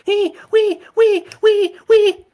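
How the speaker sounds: very high, loud piercing wee wee; squealing siren. A narrator's reading. Narrator mimics horror siren.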